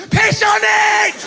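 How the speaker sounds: high, slow shouted command as mum; mock mum yelling advice; frantic mum. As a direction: bark the order like a panicking mother. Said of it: "My mum sprang into action".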